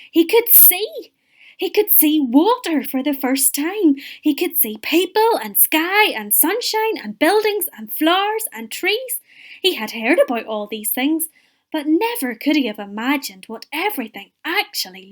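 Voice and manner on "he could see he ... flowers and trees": lifted, much higher, excited storytelling; wondering excitement. A narrator's reading. Storyteller as the blind man sees for the first time, excited. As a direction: tell it with rising amazement, pitched high.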